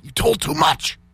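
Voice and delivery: normal talking voice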